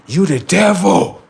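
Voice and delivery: slow, high, loud accusing voice. Retold or imitated. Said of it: "he had said".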